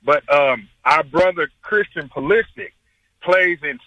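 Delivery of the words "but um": normal talking voice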